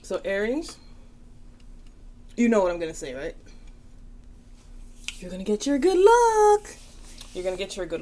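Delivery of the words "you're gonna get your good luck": excited shout. Real, not acted. Excited tarot reader calling out.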